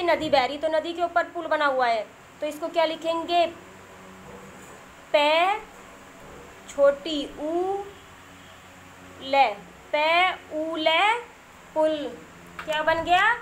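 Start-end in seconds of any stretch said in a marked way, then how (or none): 5.1-11.0 slow letter-by-letter dictation with pauses; spelling out slowly. Shown as a demonstration. Teacher spelling a word for young children, slow dictation. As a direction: draw out each letter sound for learners.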